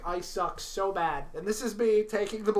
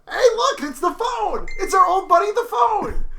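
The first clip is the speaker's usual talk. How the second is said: excited shout; 11 semitones higher, 9 dB louder than usual. Real, not acted. Phone rings, mock greeting.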